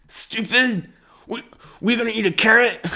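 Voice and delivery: put-on high, childish sneering voice; mocking kid self. Remembering his kid self sneering at a horse, high childish voice. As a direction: play your dumb little kid self scoffing at animals. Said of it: "when I was a kid".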